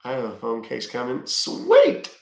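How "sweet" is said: squeaky voice. Acted. Squeaky reply amid old-lady chat.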